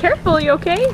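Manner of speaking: high, loud worried call; sharp playground warning. Real, not acted. Child bounced too hard.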